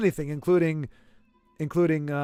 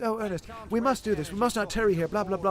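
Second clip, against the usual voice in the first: a lightly hammed-up British stage accent, higher; mock British accent; 4 semitones higher, 4 dB quieter than usual. Mocking how every cast plays Ernest, in a light British accent. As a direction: parody the stuffy British Ernest, a touch posh-theatrical. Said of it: "everybody's always doing the British accent".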